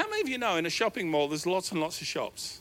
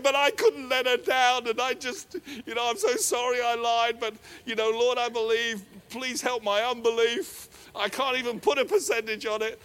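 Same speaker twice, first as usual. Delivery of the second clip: earnest cry to God; 5 semitones higher, 4 dB louder than usual. Retold or imitated. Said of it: "And I'm crying out to God".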